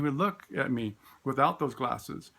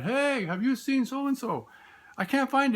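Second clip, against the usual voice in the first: pretend searching; 10 semitones higher than usual. Teacher pretends to a student, high hammed-up calling.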